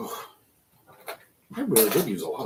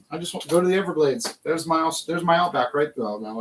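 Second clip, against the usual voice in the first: ordinary delivery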